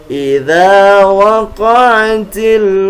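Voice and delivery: slow, careful Quran recitation; over enunciating. Shown as a demonstration. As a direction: chant each syllable deliberately. Recites the verse.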